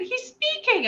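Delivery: very high, breakthrough excitement; amazed announcement. Retold or imitated. Mother telling her neighbor he's speaking, high and amazed.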